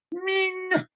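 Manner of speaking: sparkly high "Ming!"; ping it like a shiny sparkle; mock sparkle sound for the weather; high ding sound effect.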